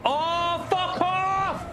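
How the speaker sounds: high-pitched angry swearing; swearing tirade. Real, not acted. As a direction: rage at the other drivers. Crashed in race, raging at others.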